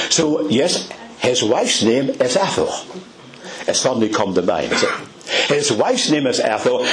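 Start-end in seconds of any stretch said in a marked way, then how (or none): none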